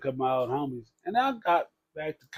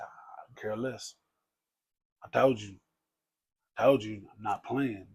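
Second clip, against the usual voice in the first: a hint of a stern stepfather, softer and lower; low, stern warning; 4 semitones lower, 8 dB quieter than usual. Stepfather warning about the drug test, low and stern. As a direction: lay down the law quietly.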